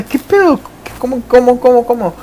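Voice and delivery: high-pitched, stammering bewilderment; mock panic, tripping over the repeated questions; flustered panic. Caught skipper sees mom at the Six, flustered.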